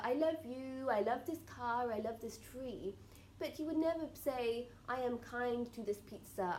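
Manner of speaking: ordinary talk, nothing marked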